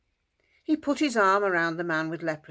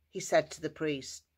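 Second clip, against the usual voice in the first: plain reading voice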